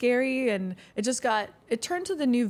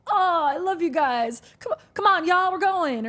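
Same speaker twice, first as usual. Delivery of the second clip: excited Southern reply; 8 semitones higher, 4 dB louder than usual. Retold or imitated. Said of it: "I'm like".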